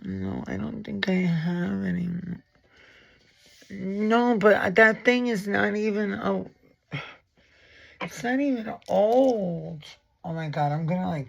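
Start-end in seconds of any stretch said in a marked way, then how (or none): 0.0-2.1 a real disappointed whisper while searching; whisper to self. Real, not acted. 3.7-9.8 mock crying. Real, not acted. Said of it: "I mean, I am crying".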